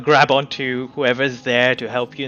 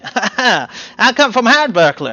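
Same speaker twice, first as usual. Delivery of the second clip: giggly little gnome; 4 semitones higher, 6 dB louder than usual. Acted. Gnome introducing himself to the party, giggly. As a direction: introduce yourself with nervous giggles.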